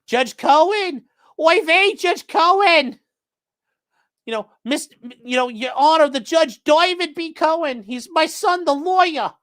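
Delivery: hammed-up Yiddish accent kvetch. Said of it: "he wrote".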